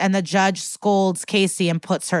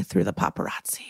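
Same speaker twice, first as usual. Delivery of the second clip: low, near-whispered finish; dramatic hush; 11 semitones lower, 7 dB quieter than usual. Real, not acted. Narrating the judge's shamed exit, low hushed ending. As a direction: drop right down to a husky hush. Recap tailing off for effect.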